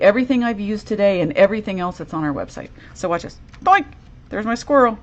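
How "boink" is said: a bouncy spoken boink as the card pops up; playful boink. Pretend. Crafter adds a cartoon boink as her card pops open. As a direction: pop the word out like a cartoon sound.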